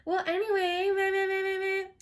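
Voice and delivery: high, loud, droning imitation of a chattering girl; mock chatter. Imitating the caller talking his ear off, high mocking chatter. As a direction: babble on one high pitch, mocking her. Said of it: "Talking his ear off".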